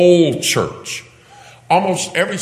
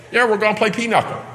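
fast, high pretend eagerness; put-on excited chirp; mock enthusiasm; 10 semitones higher, faster than usual. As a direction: toss it off as an over-keen game player. Mocks a shallow idea of fellowship.

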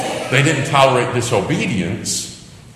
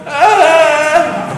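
imitated child wail; 8 dB louder than usual.

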